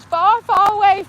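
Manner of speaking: cartoon voice calling out; silly put-on voice. Mock answer, Forrest reference.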